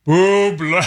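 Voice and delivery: long calling-out cry. Pretend. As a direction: call out like a mating cry. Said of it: "Stop doing the [name] call".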